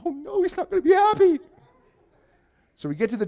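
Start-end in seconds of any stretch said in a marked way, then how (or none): 0.1-1.4 very high, squeaky echo of dread; mock panic. Visitor echoes the church man's warning, mock panic. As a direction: wail it in hammed-up alarm.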